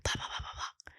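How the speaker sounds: very soft whispered babble; hushed, voiceless blah-blahs. Shown as a demonstration. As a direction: whisper filler words privately into someone's ear. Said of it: "Go in that person's ear".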